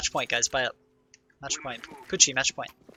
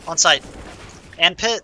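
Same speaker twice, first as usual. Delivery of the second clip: loud callout; 4 semitones higher, 8 dB louder than usual. Real, not acted. Callouts shouted mid round.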